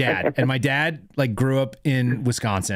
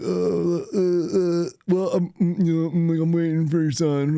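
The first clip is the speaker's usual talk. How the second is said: put-on a lightly raised, hesitant kid stammering out an excuse; nervous stammer; 5 semitones higher than usual. Stoner kid caught by his friend's dad, nervously stammering. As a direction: stall and fumble like a scared teen.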